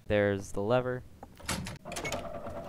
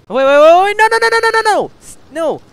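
frantic high shrieking no, no, no; panicked screaming; 11 dB louder, faster than usual. Real, not acted. Monster chase, panicked pleading.

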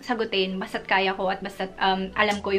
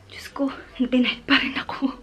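despairing whisper; 3 semitones higher than usual. Retold or imitated. Despair after visa denial.

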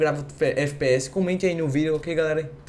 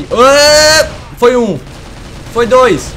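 excited shout; 7 semitones higher, 11 dB louder, much slower than usual. Real, not acted. Celebrating kills in game.

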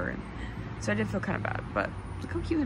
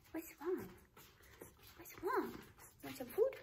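higher, softer pet voice; 9 semitones higher, 12 dB quieter than usual. Real, not acted. Owner asking her puppy what's wrong, soft baby talk. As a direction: coo it to a little puppy.

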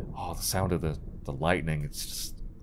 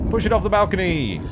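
excited shout; 10 semitones higher, 5 dB louder than usual. Real, not acted.

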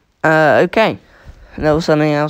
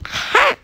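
gloating ha. Real, not acted. Enemy immune, gloating.

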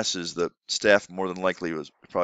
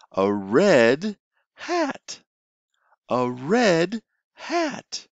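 each word stretched out and higher; very slow modelling; 7 semitones higher, much slower than usual. Shown as a demonstration. Teacher modelling new words for a student, very slow and high. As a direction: model the words slowly for the child. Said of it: "You could say".

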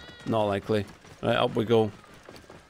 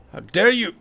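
mock outrage; 7 semitones higher, 6 dB louder than usual.

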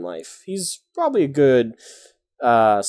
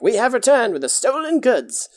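higher, proud heroic declaration; proud announcement; 11 semitones higher than usual. Pretend. Player announcing the party's triumphant return, proudly. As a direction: announce it like a superhero. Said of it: "you're like".

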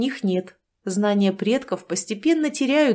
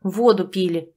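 grumbled. Acted. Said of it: "буркнула я".

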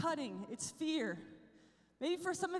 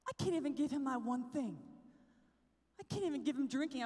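normal talking voice

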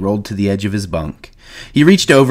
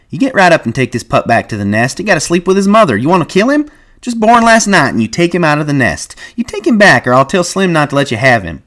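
high, loud angry scolding; scolding George; 8 semitones higher, 5 dB louder than usual. A narrator's reading. George scolding Lenny to return the pup, angry and loud. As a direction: scold him like a reckless child.

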